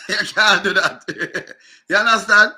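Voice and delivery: talking through real laughter; laughing. Real, not acted. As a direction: crack up while making the point. Laughs at failed B attempt.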